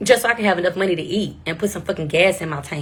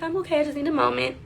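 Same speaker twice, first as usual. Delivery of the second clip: higher, teary voice trying to hold it together; tearful; 8 semitones higher, 4 dB quieter, faster than usual. Retold or imitated. Crying in front of the doctor, insisting she's okay through tears. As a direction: say you're fine while still crying. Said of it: "I'm in the doctor's office crying".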